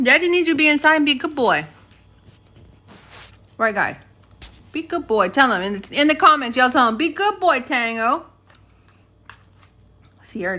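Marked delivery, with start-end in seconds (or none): 0.0-1.6 higher, louder coaxing pet talk; talking to the dog. Acted. 4.7-5.3 talking to the dog. Acted. 7.0-8.3 higher, louder coaxing pet talk; talking to the dog. Acted. Voicing the comments to the dog.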